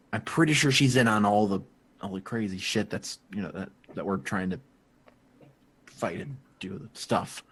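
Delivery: soft, low confidential warning; hushed warning. Acted. Edgar warning the crew quietly about Ace. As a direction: warn him under your breath.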